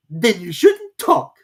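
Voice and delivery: loud, slow, cutting Hatter; rude Hatter. A narrator's reading. Hatter snubbing confused Alice, rude. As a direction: slap her down rudely.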